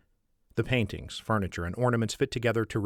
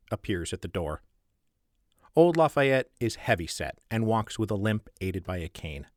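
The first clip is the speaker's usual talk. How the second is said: usual speaking voice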